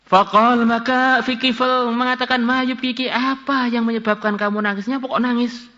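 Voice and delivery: high-pitched, sustained hadith reading; scripture recitation. Acted. Preacher reading Kifl's question to the weeping woman, high-pitched recitation.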